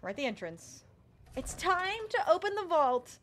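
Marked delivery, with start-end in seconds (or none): 1.4-3.2 dramatic announcement. Pretend. Dramatic announcement at vault door.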